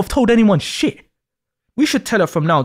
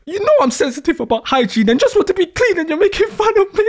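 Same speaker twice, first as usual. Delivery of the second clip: whiny half-crying; 11 semitones higher than usual. Acted. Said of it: "don't cry".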